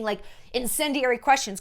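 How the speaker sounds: ordinary talk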